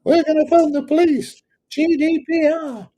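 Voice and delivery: high, hammed-up voice of an irate property owner; mocking angry complainer. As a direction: squeak it out like someone kicking off. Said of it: "those that kick off".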